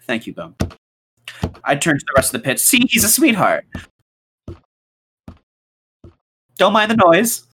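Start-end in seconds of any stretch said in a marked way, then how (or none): 2.6-7.4 higher, louder call over the noise; loud cover. Acted. Host covering for the golem to the family, loud forced cheer. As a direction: shout reassurance over the racket.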